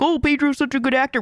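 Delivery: high, sarcastic mock gush; mocking fans. Mocking the fans who praise Pedro, high sarcastic gush. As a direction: parrot the fans with a sneer. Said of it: "Everybody's like".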